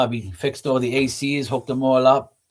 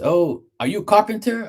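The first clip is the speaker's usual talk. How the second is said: much higher, eager question; imitating the worker; 10 semitones higher than usual. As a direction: pitch it up like the amazed worker. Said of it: "So then he says".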